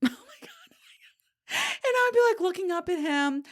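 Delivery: high-pitched giddy outburst; loud, squealy rush of excitement. Real, not acted. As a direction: overcome with excitement, voice shooting way up. Giddy over her own retold memory.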